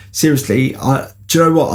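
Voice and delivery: ordinary talk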